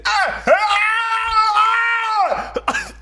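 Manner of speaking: long, high mock scream; loud drawn-out comic wail; imitated screaming. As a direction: scream at the TV, hammed up. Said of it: "he's yelling at the T V".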